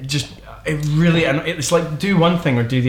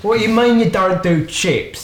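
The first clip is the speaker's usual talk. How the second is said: a higher-pitched, indignant Brit abroad; English tourist; 7 semitones higher than usual. Retold or imitated. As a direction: play a fussy tourist complaining. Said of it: "an English person on holiday".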